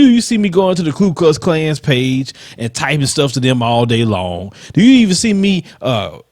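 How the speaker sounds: ordinary delivery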